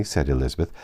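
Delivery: ordinary delivery, nothing marked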